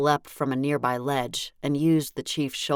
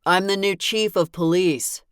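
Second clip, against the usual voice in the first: louder, carrying, higher announcement; a firm, louder police-chief declaration; loud, clear voice; 5 semitones higher, 5 dB louder than usual. Acted. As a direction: state it to the whole room. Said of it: "he said in a loud, clear voice".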